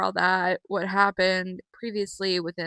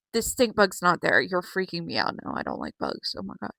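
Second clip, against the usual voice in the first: usual speaking voice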